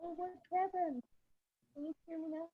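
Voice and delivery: muffled and distant. Real, not acted. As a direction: talk through a wall. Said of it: "it sounds like you're, like, muffled".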